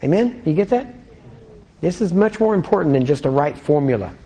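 ordinary talk

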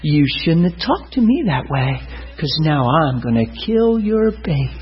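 slow, menacing voice dropped from the frenzy; sinister demon. Acted. Preacher voicing demon threatening pregnant Teresa, sinister. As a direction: threaten slowly as a possessed woman. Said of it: "this sinister look came on her face".

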